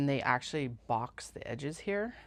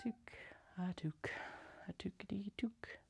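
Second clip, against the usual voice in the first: playful unvoiced whisper; whispered singsong; 4 semitones higher, 11 dB quieter than usual. Real, not acted. Putting on her toque, whispering a silly rhyme.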